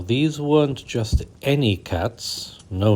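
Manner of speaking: usual speaking voice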